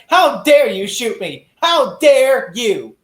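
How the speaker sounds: slow, high, loud mock outrage; hammed-up affronted cry; mock indignation. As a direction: drag it out like a scandalized victim. Mocks dying character's offended reaction.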